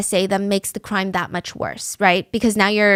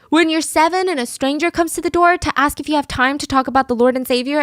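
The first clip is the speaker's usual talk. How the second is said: ordinary delivery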